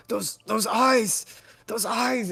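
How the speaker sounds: high, drawn-out haunted cry; entranced horror. Acted. Man grabs the player by the collar, entranced horror. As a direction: cry out as if seeing a terrible vision. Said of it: "snaps back into this trance".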